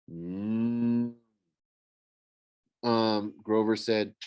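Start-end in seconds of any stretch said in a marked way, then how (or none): 0.1-1.2 drawn-out humming moo; cow's moo. A narrator's reading. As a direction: imitate a cow lowing on one note. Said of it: "Bessie lowered".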